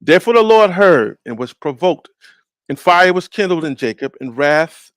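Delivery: forceful scripture proclamation, easing at phrase ends; scripture reading. Acted. Preacher rereading verses before discussing them, emphatic scripture reading.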